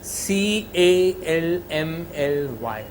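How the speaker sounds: "C A L M L Y" spelled letter by letter, very slow; spelled out slowly. Shown as a demonstration. Teacher to students, spelling calmly out slowly. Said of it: "I'm stressing".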